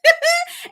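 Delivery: high, loud giggle; laugh. Real, not acted. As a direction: break into a mischievous high giggle. Laughs about sneaking as a child.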